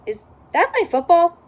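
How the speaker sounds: high, loud indignant question; surprised question. Acted. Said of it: "asks Bob".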